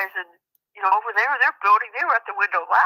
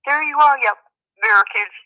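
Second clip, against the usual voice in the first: taunting; 6 semitones higher than usual. Retold or imitated. Nephew jeering at American border patrol, taunting. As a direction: needle them from across the line. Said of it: "was taunting American Border Patrol".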